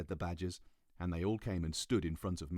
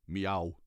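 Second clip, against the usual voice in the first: cat meow; 5 dB louder than usual. Acted. Cat speaks.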